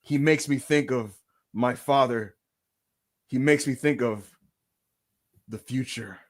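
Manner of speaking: low, slow, portentous musing; long dramatic pauses, deadpan; The Rock. Retold or imitated. Wrestler musing about a champion on video, mock-profound. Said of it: "it'll be like".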